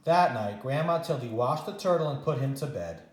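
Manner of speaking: usual speaking voice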